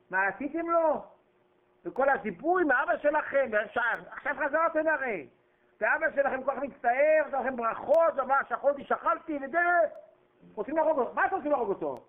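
high, urgent scolding of the brothers; impassioned rebuke. Acted. Preacher scolds the brothers for wanting to kill Joseph, pitched high and urgent. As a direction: rebuke them at full heat.

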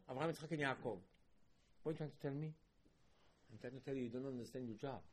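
ordinary delivery

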